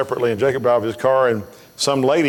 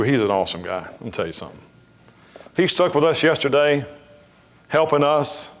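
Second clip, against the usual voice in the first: ordinary talk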